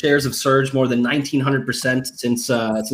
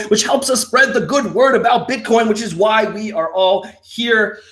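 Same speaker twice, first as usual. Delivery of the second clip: hype build-up; 7 semitones higher than usual. Acted. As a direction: build it up like a rally cry. Builds pitch to hype climax.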